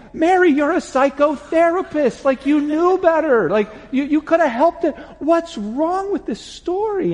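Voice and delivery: high, loud exasperated scolding; loud mock outrage at the therapist; getting mad. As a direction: scold her for missing it. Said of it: "I'm getting mad at Mary".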